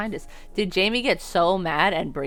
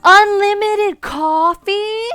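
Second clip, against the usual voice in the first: high, loud, drawn-out disbelief; amazed shout; 12 dB louder, much slower than usual. Real, not acted. Streamer reacting to free coffee, amazed high shout. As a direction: shriek it slowly in astonishment.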